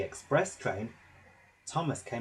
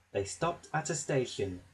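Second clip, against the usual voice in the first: usual speaking voice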